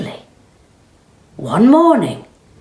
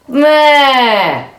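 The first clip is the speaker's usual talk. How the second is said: loud, higher; loud triumphant cow; 9 semitones higher, 8 dB louder than usual. Acted. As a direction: declare it triumphantly. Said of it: "said one of the cows, nodding".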